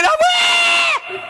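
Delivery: high, slow, stretched-out shout; mock yell. Rival shouts during the fight, high drawn-out yell. Character exclaims in fight story.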